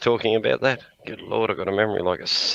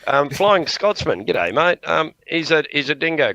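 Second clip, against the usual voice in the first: calling out greeting; 9 semitones higher, 5 dB louder than usual. Real, not acted. Greeting a viewer in chat.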